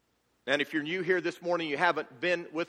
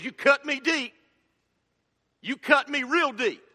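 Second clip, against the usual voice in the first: hurt Donkey; 8 semitones higher, 4 dB louder than usual. Retold or imitated. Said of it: "Donkey is hurt".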